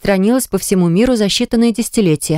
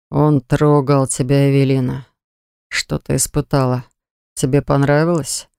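hoarse dark lord; 4 semitones lower than usual. Acted. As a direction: rasp it out, possessive and low. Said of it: "хрипло произнёс". (хриплый ревнивый голос)

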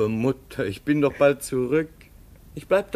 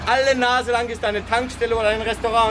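much higher, fast, breezy; cheerful reassurance; 10 semitones higher, faster than usual. Acted. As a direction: brush off her fears brightly. Son cheerfully reassures mother. (fröhlich beschwichtigend)

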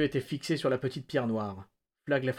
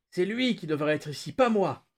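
narrated yell; 6 semitones higher, 3 dB louder than usual. A narrator's reading. Prisoner insists his partner belongs here, yelling. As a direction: protest rising to a loud, high finish. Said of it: "hurla-t-il".

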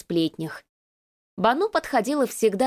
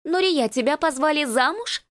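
ringing mincing voice; 6 semitones higher than usual. Acted. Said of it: "Звонкий и жеманный голосок".

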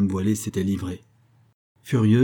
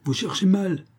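higher, sharp scolding of clumsy henchmen; 10 semitones higher than usual. A narrator's reading. Veiled lady scolds her men searching the pond, high and sharp. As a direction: snap at the searchers for failing. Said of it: "gourmandait son personnel".

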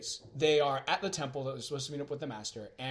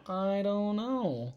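higher, slow, drawn-out singsong; theatrical wondering; 5 semitones higher, much slower than usual. Pretend. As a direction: stretch every word in mock mystery. Answers own rhetorical question theatrically.

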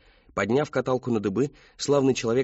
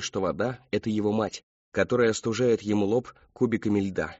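ordinary delivery

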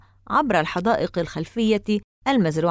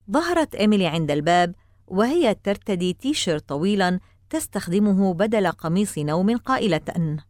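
plain reading voice

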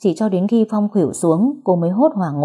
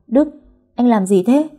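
startled; 6 semitones higher, 4 dB louder than usual. A narrator's reading. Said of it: "Duyên có chút hốt hoảng".

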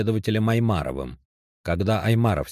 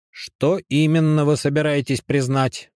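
higher, louder stern questioning; 9 semitones higher, 5 dB louder than usual. A narrator's reading. Оперативник допрашивает задержанного, stern official tone. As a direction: press him like an interrogator. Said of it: "строгим голосом".

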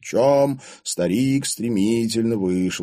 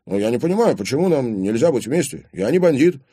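higher, raised-volume pleading argument; agitated protest; 10 semitones higher, 6 dB louder, faster than usual. A narrator's reading. Boyfriend asks father why he objects, agitated. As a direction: argue your case urgently.

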